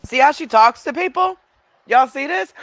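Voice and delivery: loud, high, mocking call to the viewers. Real, not acted. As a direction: point her out to everyone, loud and high. Showing viewers her rude replies.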